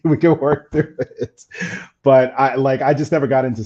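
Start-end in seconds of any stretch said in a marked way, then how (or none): 0.0-1.4 laughing. Real, not acted. Joking about smokers' breath.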